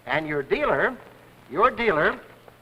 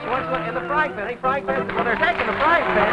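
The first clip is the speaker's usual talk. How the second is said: excited; 6 semitones higher than usual. Real, not acted. Live one-minute hunt, music playing.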